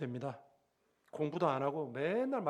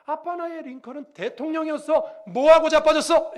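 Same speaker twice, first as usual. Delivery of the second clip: mocking retort; 9 semitones higher, 13 dB louder than usual. Son mocks father in retort.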